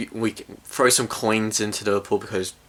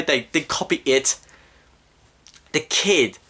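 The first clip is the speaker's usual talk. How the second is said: normal talking voice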